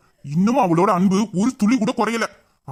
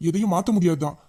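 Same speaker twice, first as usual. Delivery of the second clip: usual speaking voice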